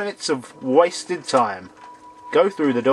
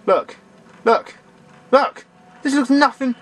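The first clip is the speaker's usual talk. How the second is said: rising look calls; 8 semitones higher than usual. Real, not acted. Calling the player's eye up the scale. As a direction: call look, climbing every time.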